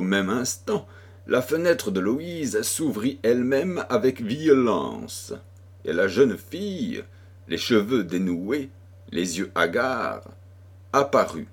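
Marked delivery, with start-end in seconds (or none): none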